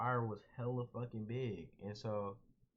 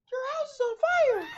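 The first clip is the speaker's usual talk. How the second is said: much higher, loud panicked woman's shriek; shocked lady; 14 dB louder than usual. Retold or imitated. Neighbor lady warning the family at the door, shrieking high and loud. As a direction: shriek it like a frantic stranger at the door. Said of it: "never heard this much shock in nobody's voice".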